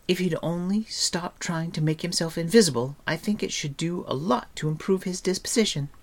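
put-on very high-pitched girlish character voice; high, chatty heroine defending a friend; chattering Eilonwy. A narrator's reading. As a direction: talk on earnestly in a high, lifted voice. Narrator voices Eilonwy defending the dwarf.

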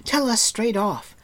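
high, loud angry scolding; angry Taran. A narrator's reading. Taran scolding Gurgi, high and loud. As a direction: scold him hotly.

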